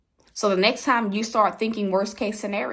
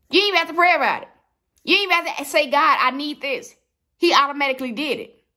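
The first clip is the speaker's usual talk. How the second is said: fired-up preaching; 6 semitones higher, 4 dB louder, faster than usual. Real, not acted. Preacher builds to emphatic peak.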